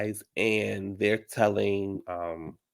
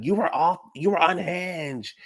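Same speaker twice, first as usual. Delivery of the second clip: mock yell; 7 semitones higher, slower than usual. Kyle leans up yelling at Sutton, high mock yell. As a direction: imitate someone going off, pitched up. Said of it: "she starts yelling at Sutton".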